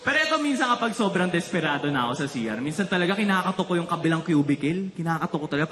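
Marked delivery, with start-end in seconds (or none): none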